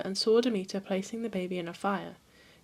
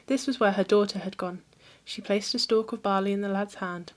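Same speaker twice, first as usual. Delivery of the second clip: ordinary delivery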